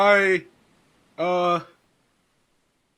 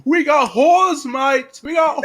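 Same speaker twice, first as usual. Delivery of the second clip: loud higher bellow across the deck; yelling; 7 semitones higher, 7 dB louder than usual. Acted. Grog bellowing up from below deck, loud yell. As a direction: yell it out with glee. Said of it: "he just yells out".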